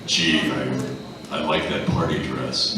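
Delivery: lightly deepened Batman growl; a hint of a gravelly Batman, deadpan; silly line in Batman voice. Shown as a demonstration. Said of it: "want to hear it in Batman's voice".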